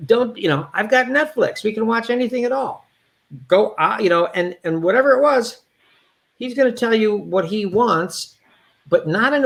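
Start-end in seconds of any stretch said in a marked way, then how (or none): 0.1-4.0 much higher, dismissive; imitating the brother putting him down; cruel brother. High, dismissive scolding. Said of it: "he would say it like this".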